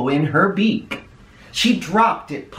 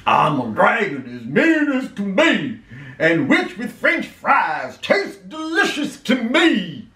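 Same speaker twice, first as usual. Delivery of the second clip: menacing storybook dragon voice; hungry dragon threatening a witch; scary dragon roar; 4 semitones higher than usual. A narrator's reading. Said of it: "a roar that was scary and loud".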